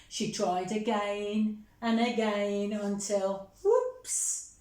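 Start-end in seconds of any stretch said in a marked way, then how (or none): 3.6-4.5 much higher startled exclamation; whoops. A narrator's reading. Teacher reading the mishap to children, startled whoops. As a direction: sing out the mishap.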